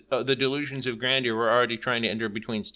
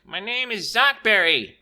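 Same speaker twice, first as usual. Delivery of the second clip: higher, louder, slow proud shout; 10 semitones higher, 6 dB louder than usual. A narrator's reading. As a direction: shout the name proudly, slowly. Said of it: "Stick proudly shouted it".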